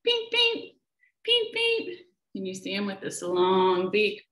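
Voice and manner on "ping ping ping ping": very high pinging bird call; ping it out sharp and high; tiny high peep; woodcock call. A narrator's reading. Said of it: "This woodcock calls".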